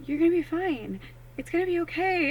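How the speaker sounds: high, sing-song comfort for a friend; high reassuring voice. Retold or imitated. Comforting tribe members, high reassuring voice. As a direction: soothe them like a worried friend in a high voice. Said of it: "people in the tribe that I'm like".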